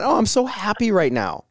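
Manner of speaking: gushing with a hollow edge; mock gushing. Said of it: "that's the vibe you pick up".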